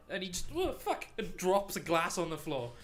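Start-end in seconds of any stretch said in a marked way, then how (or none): 0.5-1.1 high startled "oh fuck"; startled yelp. Acted. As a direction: jump out of your skin, swearing. Startled barman drops glass.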